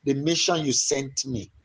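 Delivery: passionate repentance prayer that swells into shouts; fervent prayer. Shown as a demonstration. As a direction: cry out to God for pardon, building and falling. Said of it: "Go ahead and pray".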